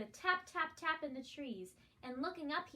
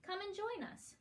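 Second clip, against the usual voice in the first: lively otter cubs; 8 semitones higher than usual. A narrator's reading. Otter cubs inviting bunny.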